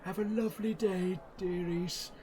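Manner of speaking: put-on high, doddery old man voice; sweet old shopkeeper waving goodbye; old gnome. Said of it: "he's like".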